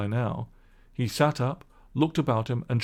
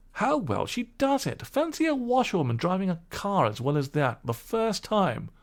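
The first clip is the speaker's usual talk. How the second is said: higher, lightly impressed gentleman; admiring gentleman; 8 semitones higher than usual. Acted. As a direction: marvel at her driving.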